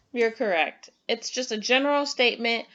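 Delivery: normal talking voice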